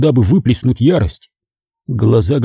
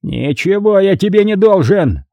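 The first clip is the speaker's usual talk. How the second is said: raised, furious outburst; exploding; 8 semitones higher than usual. A narrator's reading. Cardinal explodes at the begging prisoner, raised and furious. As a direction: blow up at him. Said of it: "взорвался он".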